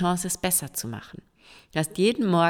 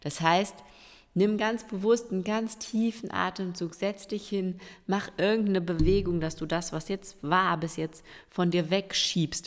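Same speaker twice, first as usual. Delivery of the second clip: normal talking voice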